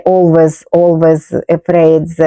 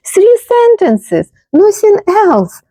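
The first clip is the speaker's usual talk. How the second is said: exasperated disbelief; 13 semitones higher, slower than usual. Real, not acted. Exasperated at a short card.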